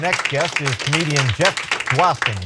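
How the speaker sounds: big, high announcer voice; announcer intro. Acted. Host introducing the comedian in an announcer voice. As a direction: sell the next act to the crowd.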